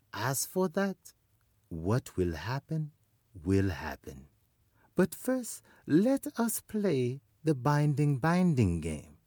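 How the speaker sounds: high, soft trickster voice; high spider voice. Acted. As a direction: play the sly little spider.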